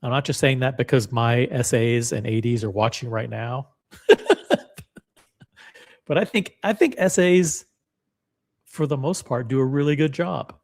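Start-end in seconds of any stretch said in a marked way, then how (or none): 4.1-4.8 laugh. Real, not acted. Laughs at own joke.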